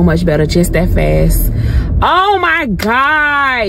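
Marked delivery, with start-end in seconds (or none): 2.0-3.7 slow, stretched-out oh my God rising high; amazed gasp. Real, not acted. Driving the fixed car, amazed at how smooth it rides. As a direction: let the relief spill out in a long drawn breath. Car suddenly riding better.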